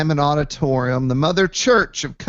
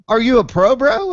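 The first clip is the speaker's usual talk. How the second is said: higher and louder, playful; excited question; 8 semitones higher, 5 dB louder than usual. Retold or imitated. Asking the Uber driver if he's a pro, excited. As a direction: toss it out pumped up.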